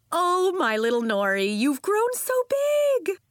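loud, high, delighted greeting; effusive auntie squeal of affection; gushing aunt. A narrator's reading. Aunt gushes greeting her niece.

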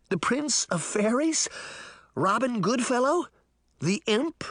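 very high, disbelieving questions; shocked. A narrator's reading. Boy listing his titles to blank girls, shocked. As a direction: prompt them incredulously, each title high.

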